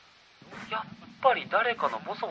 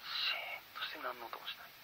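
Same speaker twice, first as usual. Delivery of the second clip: voiceless whisper, much softer; eerie whisper; 3 semitones lower, 13 dB quieter than usual. A narrator's reading. Narrator describing dead silence in the forest, whispered. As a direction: let the silence swallow the words.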